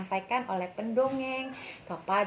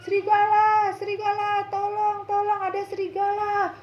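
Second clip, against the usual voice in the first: high, loud fake call for help; boy fakes a wolf alarm, shouting; pretend yell; 12 semitones higher, 7 dB louder than usual. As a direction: shout it like a prank. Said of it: "lalu berteriak".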